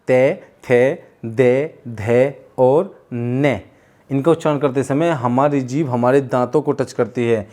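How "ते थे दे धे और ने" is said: "ते, थे, दे, धे और ने" with the tongue at the teeth; slow dental drill. Shown as a demonstration. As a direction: say along "ते थे दे धे ने". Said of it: "बोलिए साथ साथ".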